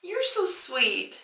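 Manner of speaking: high, shy and giggly; bashful. Real, not acted. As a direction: say it embarrassed and coy. Shy off-camera banter.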